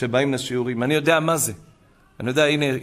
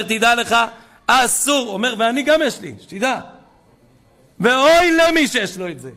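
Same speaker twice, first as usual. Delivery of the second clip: louder and higher, pressing each word as a warning; fervent warning; 9 semitones higher, 4 dB louder than usual. Retold or imitated. Old man warns the rabbi about iPhones, hammered out loudly. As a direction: insist like you are warning him.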